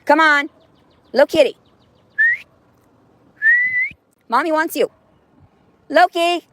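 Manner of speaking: high, loud calling a cat. Real, not acted. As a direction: call the cat from the door.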